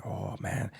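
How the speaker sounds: hushed whisper of a cellmate; whispering in the cage. Retold or imitated. Cellmate whispering to him in the bus cage. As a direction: breathe it out under your breath. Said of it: "You just have to whisper".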